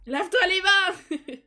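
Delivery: high, loud, slow comic yell; exasperated mock scolding shout; shouted order. Real, not acted. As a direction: bellow it at the careless Sim. Yelling at a Sim in game.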